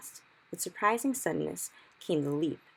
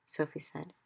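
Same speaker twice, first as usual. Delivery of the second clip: ordinary delivery, nothing marked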